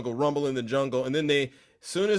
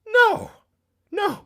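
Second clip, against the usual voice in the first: sharp high no; 5 dB louder than usual. Real, not acted. Rejecting the idea.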